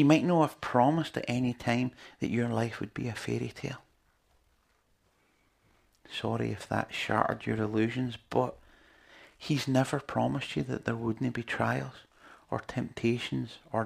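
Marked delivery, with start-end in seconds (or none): none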